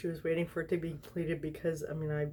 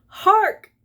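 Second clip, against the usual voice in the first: dramatic herald cry; 15 dB louder than usual. Acted. Announcing the fic title.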